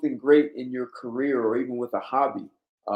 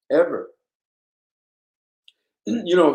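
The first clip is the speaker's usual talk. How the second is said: ordinary talk, nothing marked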